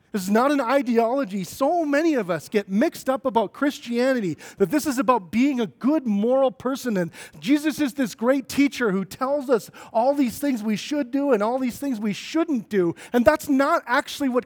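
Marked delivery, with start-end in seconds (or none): none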